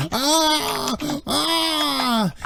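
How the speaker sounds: high-pitched acted scream of agony; drawn-out pained screams, far above the normal voice; screaming bloody murder. Game master voicing the tortured rebel, screaming bloody murder. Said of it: "the guy's just screaming bloody murder".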